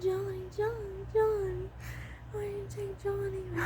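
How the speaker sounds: high, soft, mournful calling of a name; grieving plea. Retold or imitated. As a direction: call Johnny softly, heartbroken. Quoting the tests' grieving voice.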